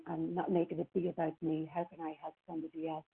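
ordinary talk, nothing marked

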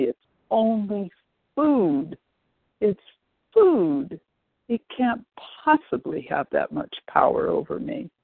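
trying to convince herself; defiant. Retold or imitated. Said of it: "stamp my feet and say".

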